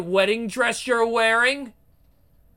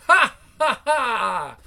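slow, loud shouted villain cackle; slow evil laugh; 4 semitones higher, 4 dB louder, slower than usual. Acted. Queen mocking her enemy dragged through dirt, slow cackle. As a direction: savour the victory with a big laugh.

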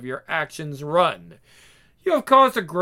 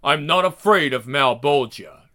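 ordinary talk

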